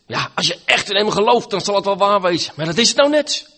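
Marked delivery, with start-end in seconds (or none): 2.6-3.5 loud and high, hammer the point home; emphatic outburst. Acted. Preacher to his congregation, driving the point home loudly. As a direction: proclaim it as the crux of the problem. Preacher stressing the sinner's objection.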